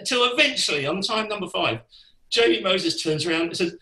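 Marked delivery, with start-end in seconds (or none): none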